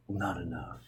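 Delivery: hushed under the breath; whispered aside. Acted. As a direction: mutter it almost silently.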